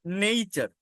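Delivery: নেচার said as "nei-cha"; teacher modelling নেচার; model pronunciation. Shown as a demonstration. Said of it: "এটা নেচার না".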